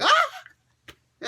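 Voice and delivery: high, loud yelp of pain; hammed-up scream as if fingers got cut; mock pain scream. He's like.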